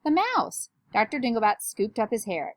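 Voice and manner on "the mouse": high-pitched storybook alarm; screamed alarm. A narrator's reading. Girl reporting a rat attack, high alarmed cry. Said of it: "screamed a girl".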